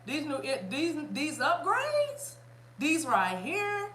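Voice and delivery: slow, high, drawn-out teasing; playful showing off. Pretend. Host teasing viewers about her upgrades, playful drawl.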